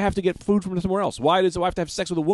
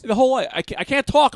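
strained, talking while holding breath; 8 semitones higher, 5 dB louder than usual. Pretend. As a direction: squeeze words out on held breath. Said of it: "I'm holding my breath".

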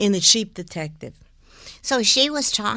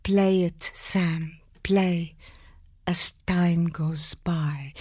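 slow, soft Bergman line ending in a whisper; slow, hushed Bergman delivery with a rolled R; Ingrid Bergman; 2 semitones lower, 3 dB quieter, much slower than usual. Shown as a demonstration. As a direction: draw it out like Casablanca, consonants crisp. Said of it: "I said".

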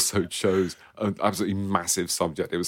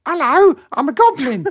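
squeaky high goblin, much louder; 14 dB louder than usual. Retold or imitated. Guest imitating great-aunt reading Mister Men, shrill goblin squeak. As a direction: shriek it like a silly goblin. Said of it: "she suddenly did the voice of what she thought a goblin".

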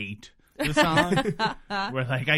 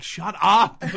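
a hint of a whiny teen snapping; 4 semitones higher, 9 dB louder than usual. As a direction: whine it like an annoyed teen. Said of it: "has this really whiny quality to it".

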